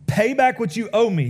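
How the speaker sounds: harsh demand. Acted. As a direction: bark it like a creditor choking a debtor. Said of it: "he demanded".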